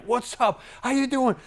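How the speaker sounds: hammed-up pushy pitch to a cautious client; mock pushy salesman. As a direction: overdo the eager closer. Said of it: "the S's are not gonna like".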